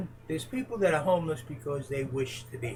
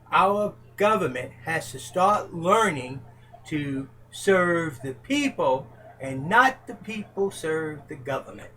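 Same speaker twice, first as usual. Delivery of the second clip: ordinary talk, nothing marked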